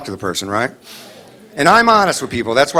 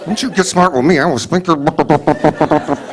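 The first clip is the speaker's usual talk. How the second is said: ventriloquist mom; slower than usual. Retold or imitated. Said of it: "you do that ventriloquist thing".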